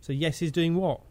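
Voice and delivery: ordinary talk, nothing marked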